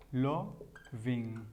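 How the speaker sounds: "loving" slowly, split into lo and ving; syllable by syllable. Shown as a demonstration. Teacher shows learners how love converts, slow syllable by syllable. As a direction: draw out each syllable of "loving". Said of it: "se convertiría".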